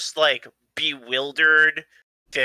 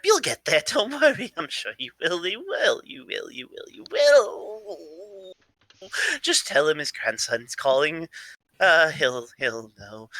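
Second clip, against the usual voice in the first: babbling, sing-song cheer that trails and leaps; excitable eccentric grandson; 4 semitones higher than usual. Acted. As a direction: pitch swooping up and down, over-eager reassurance. Plays the same character.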